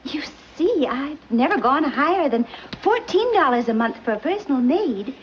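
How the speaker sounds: ordinary delivery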